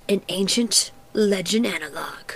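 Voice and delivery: breathy half-whisper, slightly slowed; hushed mock mystery. Host unveiling a found sewer legend, hushed and mysterious. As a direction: whisper it like an ancient secret.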